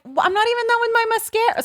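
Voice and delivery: fast, high-pitched protest; flustered protest. Retold or imitated. Niece rushed by her aunt at four o'clock, flustered protest.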